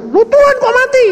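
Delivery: put-on a shrill older sister in disbelief; shocked sister. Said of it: "dia bilang". (seruan kaget bernada sangat tinggi)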